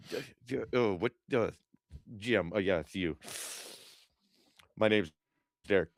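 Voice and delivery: a hint of nervous stammer; mock fumbling. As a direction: stumble over your own name on purpose. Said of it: "just sitting there mumbling".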